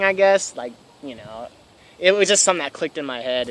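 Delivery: ordinary delivery, nothing marked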